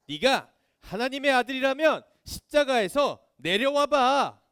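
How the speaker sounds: hammed-up heckler's challenge; mocking taunt. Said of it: "십자가에서 내려오라고 조롱했습니다".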